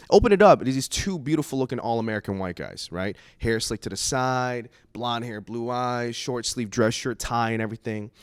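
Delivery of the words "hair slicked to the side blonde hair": ordinary talk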